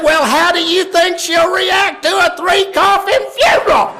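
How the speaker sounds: full-voiced, high sarcastic yell; furious father. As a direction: bellow at the wife, acting at the end of patience. Father yells back at wife.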